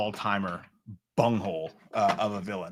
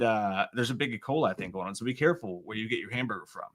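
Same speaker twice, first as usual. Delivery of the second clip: ordinary talk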